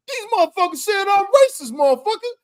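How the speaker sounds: high, indignant imitation; outraged mock voice. Retelling a live-stream man to friends, high mock outrage. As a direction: squawk it high in mock disbelief. Voicing man in retold video.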